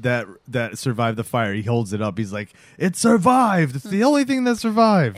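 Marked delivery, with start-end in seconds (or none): 2.8-5.2 proud boast. Retold or imitated. Proud dad holds up the hot plate that survived the fire, mock boasting. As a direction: crow it proudly, imitating him. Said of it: "he's all proud of it".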